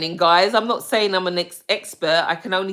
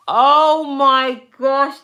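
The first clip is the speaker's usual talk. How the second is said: delighted; 6 semitones higher, 7 dB louder, much slower than usual. Real, not acted. Friend returns after illness.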